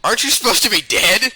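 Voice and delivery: high, rising incredulous question; mock-puzzled, pitch climbing at the end; Zarum. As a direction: ask it in pointed disbelief. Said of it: "Zarum would say this over all three".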